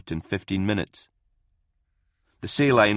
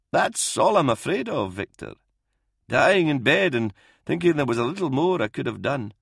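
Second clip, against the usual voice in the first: put-on higher-pitched, lilting old doctor; earnest old doctor; 7 semitones higher than usual. Old doctor admitting his fear to Victor, earnest. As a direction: confide a regret in a raised, swooping voice.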